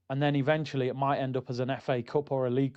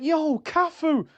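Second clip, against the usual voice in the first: starstruck greeting; 11 semitones higher, much slower than usual. Retold or imitated. Greeting the supposed legend.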